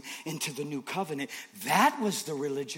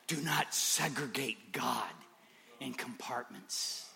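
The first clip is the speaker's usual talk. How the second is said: whisper; 4 semitones lower, 9 dB quieter, slower than usual. Acted. Intimate sermon moment.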